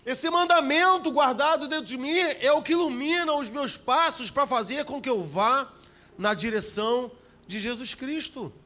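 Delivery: rising pulpit fervour; full-force preaching. Acted.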